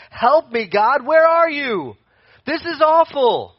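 loud, high acted cry for help. Preacher acting Paul crying out to God, loud desperate plea. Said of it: "just to cry out for help".

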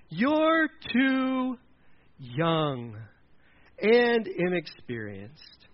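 halting, word by word put-down; slow, deliberate dismissal, last word drawn out; condescending elder. Acted. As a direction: space it out like an elder lecturing a boy. Saul lecturing young David.

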